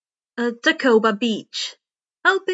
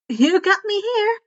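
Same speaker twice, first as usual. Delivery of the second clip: higher and louder, playful admission; cheerful girl; 7 semitones higher, 4 dB louder than usual. Acted. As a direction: caught out and amused. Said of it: "Hatsume laughed".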